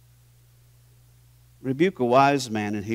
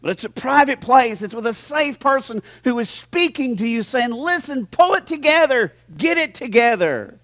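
full-force preaching; 7 semitones higher, 6 dB louder than usual. Acted. Pastor urging his church to accept correction, loud preaching. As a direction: preach it hard to the congregation.

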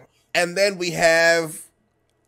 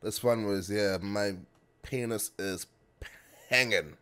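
low mumble; 6 semitones lower, 7 dB quieter than usual. Pretend.

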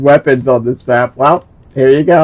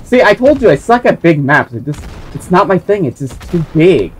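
usual speaking voice

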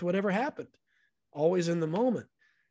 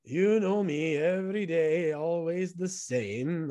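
put-on slow, higher Korean-accented old man; Chan's Korean accent; 4 semitones higher, slower than usual. Chan untying his shoes, explaining himself in a slow Korean-accented voice. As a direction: stretch it out like an easygoing elderly Korean man. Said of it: "And he says".

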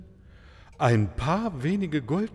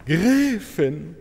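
higher and louder, gushing thanks; overjoyed; 5 semitones higher, 4 dB louder than usual. Acted. Maharaja thanks the countess, delighted and louder. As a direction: gush with delighted relief.

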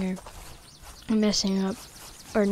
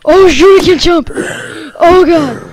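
panicked yelp; 8 semitones higher, 16 dB louder, slower than usual. Real, not acted. Panicking in a game.

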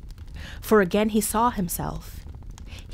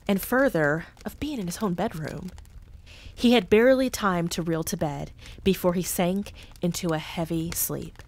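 plain reading voice

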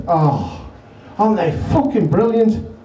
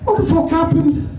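shocked manager; 9 semitones higher than usual. Retold or imitated. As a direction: squeal it out in alarm. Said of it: "He said".

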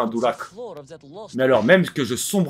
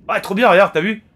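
excited; 7 semitones higher, 6 dB louder than usual. Real, not acted. Excited at the game.

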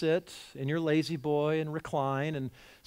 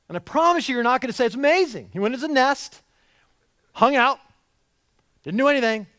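much higher and louder, mock amazement; mock amazed; 9 semitones higher, 9 dB louder, faster than usual. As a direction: gush like a naive bird watcher. Mock bird-watcher journal, preaching high.

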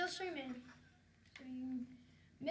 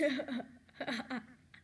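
giggle; 3 semitones lower than usual. Real, not acted. Amused by own story.

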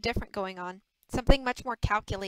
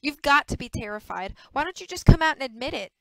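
louder, insistent demand; angry challenge; 4 semitones higher, 8 dB louder than usual. A narrator's reading. Maddie confronting Rick, angry and insistent. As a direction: press him hard to admit it. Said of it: "Maddie turned on him, her green eyes flashing".

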